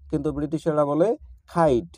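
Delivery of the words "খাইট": খাইট with an aspirated খ; খাইট, British style with a puff of air. Shown as a demonstration. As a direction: breathe out hard on the খ of খাইট. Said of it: "ব্রিটিশেরা করে খয়ের মতো".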